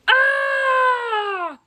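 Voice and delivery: high, loud shriek; scream. Acted. Monster leaps onto Basil with a high loud scream. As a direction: let out a startled scream. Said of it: "and he screams".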